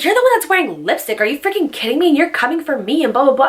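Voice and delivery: high-pitched indignant imitation; mock indignation. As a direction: mimic an offended friend snapping back. Retelling angry friend's comeback.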